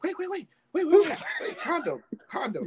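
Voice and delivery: high-pitched, repeated urgent halt; urgent interruption. Retold or imitated. Halting partner to demand condom.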